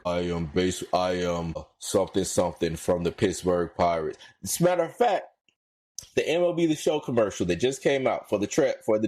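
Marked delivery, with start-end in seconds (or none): 0.0-4.2 lower, dull monotone ballplayer voice; mock a lifeless ad read, dragging it; bored ballplayer mimic. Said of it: "y'all talk like this".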